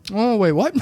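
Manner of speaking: loud, sudden mock realisation; mock double take.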